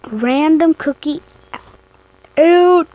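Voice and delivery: words pushed out on a burp. Real, not acted. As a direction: talk through a burp. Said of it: "Sorry, I got a burp".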